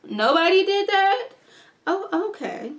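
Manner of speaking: indignant disbelief at the crowd; incredulous. Real, not acted. Outraged at the bystanders.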